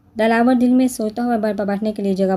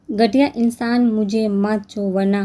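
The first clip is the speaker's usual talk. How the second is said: usual speaking voice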